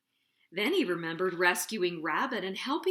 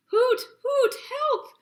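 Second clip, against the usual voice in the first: high squeaky owl cry; squeaky little voice; 14 semitones higher, 7 dB louder, slower than usual. Acted. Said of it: "came a squeaky little voice".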